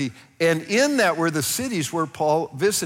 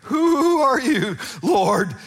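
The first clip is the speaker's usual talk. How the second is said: much higher, slow, trembling with awe; awestruck plea; 8 semitones higher, 3 dB louder, slower than usual. Shown as a demonstration. Saul struck down asks the blinding voice, awestruck plea. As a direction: ask from the ground, overwhelmed. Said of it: "No, it's like he's down on the ground".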